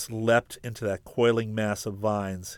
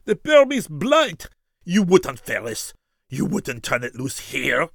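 terrified cry; 6 semitones higher, 6 dB louder than usual. Acted. Barreau recognizes the deadly vial.

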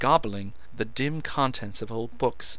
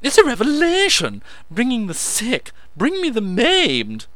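ecstatic; 13 semitones higher, 10 dB louder than usual. A narrator's reading. Meadows ecstatic proclaiming.

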